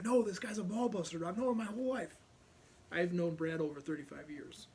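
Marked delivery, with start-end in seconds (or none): 0.0-2.2 higher, louder, half-playful insisting; insistent buddy. Retold or imitated. Brad insisting to medics he knows the guy, raised higher and louder. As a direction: push back, amused and eager. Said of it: "He's like".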